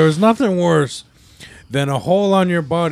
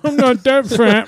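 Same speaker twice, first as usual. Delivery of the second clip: mock hurt whine; 7 semitones higher, 3 dB louder, slower than usual. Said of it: "we're like".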